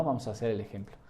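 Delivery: ordinary talk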